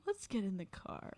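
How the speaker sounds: soft, slow retold aside; hushed, measured suggestion; muted urging. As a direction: say it slowly under your breath.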